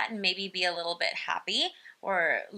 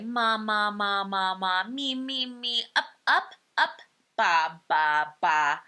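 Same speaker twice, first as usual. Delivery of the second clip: chain the syllables like a babbling infant; baby babbling; 3 dB louder than usual. Shown as a demonstration. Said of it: "They're gonna do, like".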